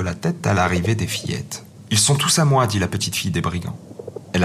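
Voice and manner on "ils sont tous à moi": possessive claim. Acted. Robber girl claims the pigeons.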